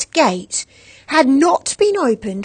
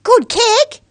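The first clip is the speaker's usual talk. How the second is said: excited praise; 13 semitones higher, 10 dB louder than usual. A narrator's reading. Girl cheering neighbour's kick.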